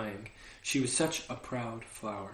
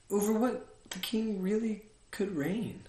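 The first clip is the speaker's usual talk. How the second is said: wondering; 8 semitones higher than usual. Acted. Prince wondering, higher voice.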